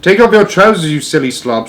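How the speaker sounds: starts high and loud, then drops; exasperated shout, easing off at the end; scornful shout. A narrator's reading. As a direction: shout impatient advice at a fool. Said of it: "someone else shouted".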